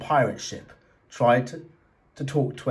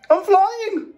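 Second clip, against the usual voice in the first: much higher, louder joyful whoop; 6 dB louder than usual. Acted. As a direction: shout it with pure delight while soaring. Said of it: "Sammy whooped".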